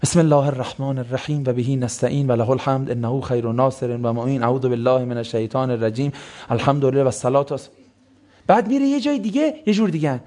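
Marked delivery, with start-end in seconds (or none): 0.0-7.7 a lightly imitated rushed formal opening prayer; mock rushed opening formula. Imitating a speaker racing through the opening prayer, a touch rushed. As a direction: rattle off the formula like a speaker at a podium. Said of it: "مثلاً میاد خب".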